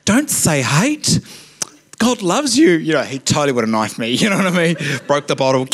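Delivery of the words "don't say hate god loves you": high, mock-pious scolding; hammed-up sanctimony, pitched well up.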